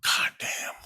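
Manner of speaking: hushed, stunned curse; whispered amazement on waking; awed whisper. Retold or imitated. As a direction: breathe it out in disbelief. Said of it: "and I'm like".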